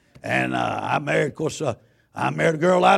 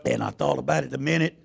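ordinary talk

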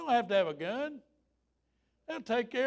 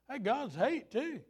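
ordinary talk, nothing marked

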